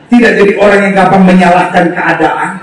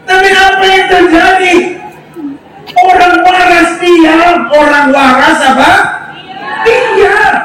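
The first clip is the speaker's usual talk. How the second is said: high, emphatic pulpit delivery; full-force preaching; 8 semitones higher than usual. Real, not acted. Preacher rallying the congregation to stay silent and trust, high forceful preaching. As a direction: drive it home to the crowd, high.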